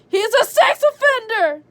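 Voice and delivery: high shouted exclamation; loud outburst. Real, not acted. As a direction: shout it out laughing. Shouting joke at the story.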